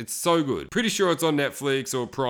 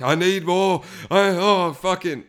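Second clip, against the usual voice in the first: louder, desperate craving; mock junkie losing it, louder; going nuts; 4 semitones higher, 5 dB louder, slower than usual. Addict who lost his cocaine, loud desperate rant. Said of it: "he just goes nuts".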